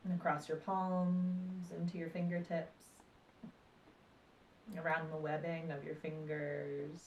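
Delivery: calm, unhurried meditation voice with long pauses; guided meditation. Acted. Meditation teacher guiding listeners through breath and body awareness, calm guided meditation.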